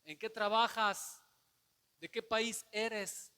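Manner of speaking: loud, slow questions; sailors interrogating. Retold or imitated. Sailors demanding answers from Jonás, loud and slow. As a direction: press him hard with questions.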